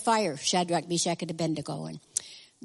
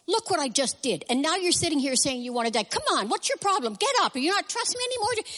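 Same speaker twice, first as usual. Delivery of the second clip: high-pitched, exasperated rant, speeding up; mock-exasperated telling-off, pitched way up; exasperated scolding; 7 semitones higher than usual. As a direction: scold in mounting disbelief, voice climbing high. Acts exasperated God scolding, hypothetical.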